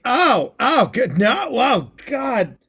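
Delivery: high mock cringe at own poetry; cringing groan. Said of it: "And it's just like".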